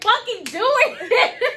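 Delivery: high, loud, slow goading; escalated mock dare. Mother re-enacting daring her son, escalated. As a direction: drag out the dare, laughing.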